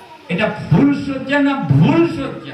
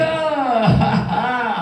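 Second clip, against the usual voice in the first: a short triumphant chuckle; gloating laugh; 3 semitones higher than usual. Acted. Husband laughing at his shocked bride. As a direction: laugh at her dismay.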